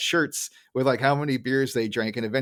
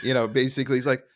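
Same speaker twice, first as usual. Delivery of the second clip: ordinary delivery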